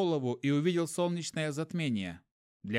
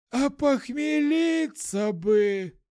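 put-on mooing drunk; 10 semitones higher, 6 dB louder, much slower than usual. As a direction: moan it like a hungover tramp. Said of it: "промычал бомж". (мычание бомжа)

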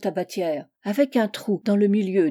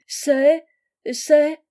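raised pitch, halting repeat; hesitant; 7 semitones higher, 7 dB louder than usual. Acted. Host timidly asking the angel's business, hesitant. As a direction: falter upward, pausing between tries.

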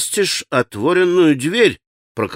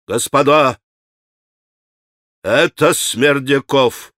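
slow, forceful accusation, only mildly raised; sudden outcry; slower than usual. A narrator's reading. Mitya suddenly names the killer, restrained outcry. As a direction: cry out the name with deliberate weight. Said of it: "закричал он вдруг изо всей силы".

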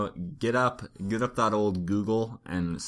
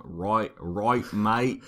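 attempted British accent, slipping toward Australian; hammed-up British accent, half Australian; bad British accent; much slower than usual. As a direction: try a mock Brit and miss. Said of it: "that sounds more Australian".

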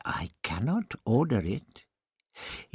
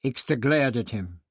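ordinary delivery

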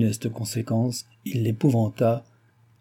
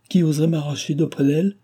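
higher-pitched exclaimed question; indignant cry; 6 semitones higher than usual. A narrator's reading. Henriette refusing to be torn from the patient's side, mildly indignant cry. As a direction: protest in a raised pitch, mildly outraged. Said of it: "s'écria-t-elle".

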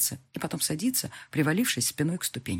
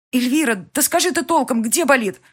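higher, louder urgent demand; frantic question; 8 semitones higher, 6 dB louder than usual. A narrator's reading. Heroine demanding where it hurts, frantic. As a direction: press her anxiously.

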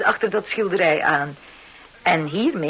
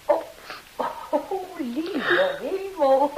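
very slow, high, breathy exclamation; shocked; 6 semitones higher, 3 dB quieter, slower than usual. Acted. Landlady walks in on the two lovers, shocked. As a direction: gasp it out, scandalised. Finds the couple embracing.

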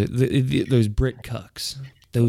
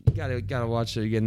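normal talking voice

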